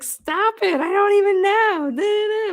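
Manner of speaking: high-pitched mock wail; whiny protest. Staffer protests to the boss on the phone, mock whiny. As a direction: imitate a flustered employee pleading helplessly.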